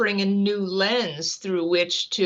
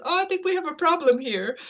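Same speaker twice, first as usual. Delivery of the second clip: higher, lightly voiced discovering scientist; Galileo voice; 9 semitones higher, faster than usual. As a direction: pitch up for a Galileo aside. Galileo quoted realizing a problem.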